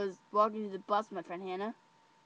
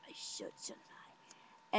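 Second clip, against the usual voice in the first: very soft, conspiratorial aside; hushed agreement; 15 dB quieter than usual. Retold or imitated. Agreeing with her friend on a nickname, hushed. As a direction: say it under your breath.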